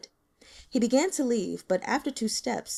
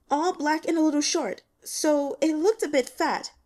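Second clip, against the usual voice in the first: put-on higher, timid girl's voice rising in fear; a scared student begging for reassurance; frightened young woman; 7 semitones higher, 4 dB louder than usual. A narrator's reading. Li Qian telling the investigator, frightened and high.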